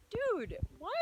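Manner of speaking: real shock at the second rainbow; stunned outburst. Real, not acted. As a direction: blurt it out, floored. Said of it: "I'm freaking out".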